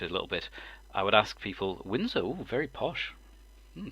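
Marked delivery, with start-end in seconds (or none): 1.8-3.9 high, swooping mock-impressed coo; playful ooh at how fancy it is. Teasing a viewer from Windsor, mock-impressed.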